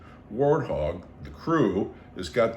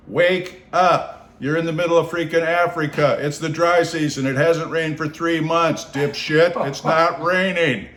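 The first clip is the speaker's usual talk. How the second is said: louder, higher exasperated scolding; scolding inner voice; 6 semitones higher, 6 dB louder than usual. Retold or imitated. His inner voice scolding him awake, sharply. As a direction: berate yourself for being an idiot. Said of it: "this other voice says".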